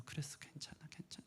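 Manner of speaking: whispered, gentle comfort; softly whispering father to crying son; tender whisper. Pretend. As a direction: soothe like a father hushing his child. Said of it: "같이 녹아져 내리면서".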